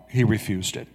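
ordinary delivery

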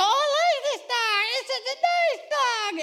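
put-on very high child voice, delighted; excited kid. Kids in the petting corral fawning, high child voice. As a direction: coo over the dog like a kid. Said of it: "the kids go".

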